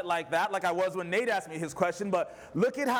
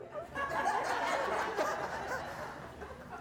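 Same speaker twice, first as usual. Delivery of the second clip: breathless chuckle rising; high wheezing laugh; 7 dB quieter than usual. Real, not acted. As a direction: laugh it off warmly. Laughs at own story.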